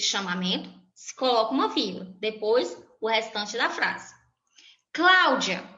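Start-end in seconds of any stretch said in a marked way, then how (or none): 4.9-5.6 calling out. Shown as a demonstration. Teacher calling Cláudia across a classroom, raised voice. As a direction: call the name out loud. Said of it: "Quando eu chamo a Cláudia".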